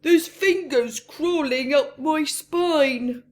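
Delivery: a spooked robber whining. A narrator's reading. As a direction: whine it out, spooked. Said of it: "Len began to whine".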